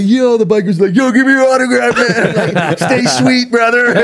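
high, eager mock fan voice; hammed-up high-pitched excitement; imitating excited bikers. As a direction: gush like a starstruck biker in a retold quote. Bikers excitedly ask for autograph.

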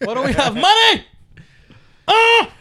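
high, loud mock-desperate yell; mock wail. Host bemoaning the show's lack of money, mock wail. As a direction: cry out in exaggerated despair, ending in a yelp. Mock wailing about being poor.

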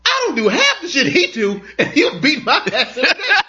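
put-on high kid voice, laughing through it; a boy's outraged inner grumble; son's imagined complaint about whoopings, high laughing kid voice.

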